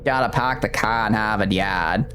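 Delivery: broad Boston accent with dropped R's. Shown as a demonstration. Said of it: "I've heard that one before".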